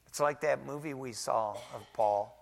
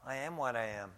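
Popeye; 7 dB quieter than usual. Retold or imitated. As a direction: toss off the line like Popeye the sailor, understated. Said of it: "I think of Popeye".

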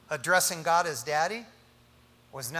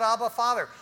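raised, higher cry to God; 5 semitones higher, 4 dB louder, faster than usual. Acted. Preacher voices believers calling to their Father, crying out. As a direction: cry out in prayer, pitched up. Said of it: "we cry out".

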